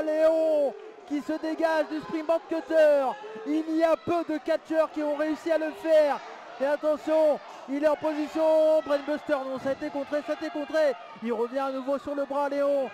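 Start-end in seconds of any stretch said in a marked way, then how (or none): 0.0-10.9 fired-up live play-by-play call; wrestling commentator. Acted. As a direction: call every move like ringside TV.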